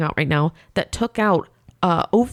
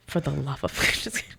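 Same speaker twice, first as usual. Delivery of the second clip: laughing whisper; 4 semitones lower, 8 dB quieter than usual. Real, not acted. Laughing aside.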